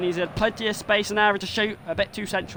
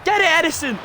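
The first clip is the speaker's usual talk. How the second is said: loud, high cry urging the keeper; will the save to happen; urging shout; 12 semitones higher, 7 dB louder than usual. Real, not acted. Gamer cheering his keeper on, high urging shout.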